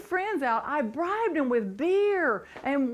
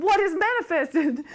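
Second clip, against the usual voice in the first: imitated baffled girlfriend; 6 semitones higher, 7 dB louder than usual. Voices a puzzled girlfriend's reply.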